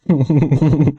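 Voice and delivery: high, loud burst of laughter; laughing. Real, not acted. Streamer reacting to being trolled, high loud laugh. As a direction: crack up loudly at the prank.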